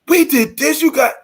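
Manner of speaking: high, excited burst of news; excited pitch. Shown as a demonstration. Partner back from an event telling prospects, excitedly. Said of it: "not gonna be as excited".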